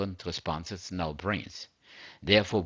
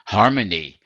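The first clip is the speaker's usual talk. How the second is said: Harmony. said loud as a title, falling at the end; loud announced heading; 9 dB louder than usual. Shown as a demonstration. Preacher naming the next topic to his listeners, loud. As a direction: proclaim Harmony. like a chapter heading.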